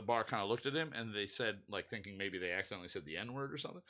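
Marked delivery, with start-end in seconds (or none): none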